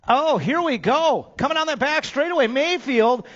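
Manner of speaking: high, loud, rising race call stretched out at the end; excited race call. Real, not acted. Announcer calling the tight finish, loud and soaring. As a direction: call the finish like it's the final lap.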